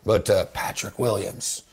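quiet, reverent tournament call; golf voice. Acted. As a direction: drop into hushed golf-broadcast tones. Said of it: "I'll go into a golf voice here".

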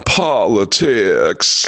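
chuckle, then one very drawn-out word; mock presidential drawl, hugely slow; bad impression stretched out for laughs; Bill Clinton. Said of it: "he just did an impression of Bill Clinton".

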